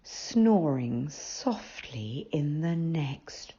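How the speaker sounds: whispered, slow storytelling; hushed narration. A narrator's reading. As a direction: breathe it like a secret.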